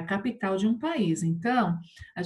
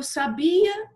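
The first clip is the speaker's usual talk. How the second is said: "sabia" misread as saBIa, BI raised; stress on BI; 9 semitones higher, 5 dB louder than usual. Shown as a demonstration. Teacher spoiling the poem to show pupils, stressed on BI. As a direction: read "sabia" wrongly with weight on BI, final A weak. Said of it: "Se eu não colocar o acento".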